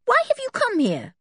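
high, indignant questioning; demanding. A narrator's reading. George to policemen on her island, demanding. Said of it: "demanded George".

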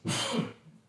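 rough throat-clearing cough; hoarse cough. Real, not acted. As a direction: cough through a sore throat. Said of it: "ferrei minha voz".

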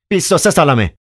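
high, agitated answer; excited. Acted. Ivan answering the doctor, high and agitated. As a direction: blurt it out worked up. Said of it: "ایوان هیجان زده".

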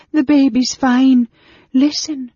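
high, loud, drawn-out wail; gasping between sobs. A narrator's reading. Servant girl reassuring the innkeeper, gasped between sobs. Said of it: "managed to gasp between sobs".

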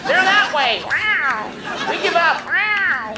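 high, whiny cat voice; cat impression. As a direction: meow like a snooty cat. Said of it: "Cats are like".

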